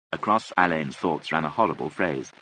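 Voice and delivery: ordinary delivery, nothing marked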